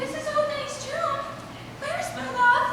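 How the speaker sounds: high squeaky lady voice; man hamming a high girl's voice; falsetto Thisbe. Acted. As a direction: play the maiden in a high falsetto. Thisbe role again.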